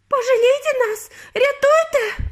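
very high, desperate cry; screaming for help. A narrator's reading. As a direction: scream out for rescue. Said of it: "кричала пані Борогар".

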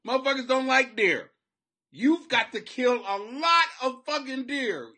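ordinary talk, nothing marked

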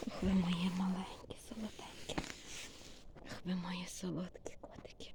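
tender whispered cooing. Real, not acted. Owner whispering lovingly to her little cats. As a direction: whisper sweetly to the kittens.

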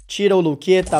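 normal talking voice

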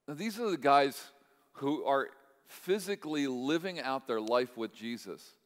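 ordinary talk

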